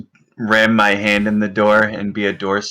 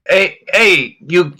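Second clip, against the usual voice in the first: mimicking guard voice; 8 semitones higher, 4 dB louder, slower than usual. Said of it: "I attempt to emulate their voice pattern".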